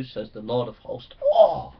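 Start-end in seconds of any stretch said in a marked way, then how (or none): 1.2-1.7 excited oh. Real, not acted. Exclaims after reading scripture.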